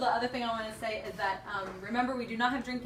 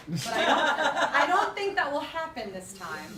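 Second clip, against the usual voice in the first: laughing; 6 semitones higher, 8 dB louder than usual. Real, not acted. Laughing about a poem gone wrong.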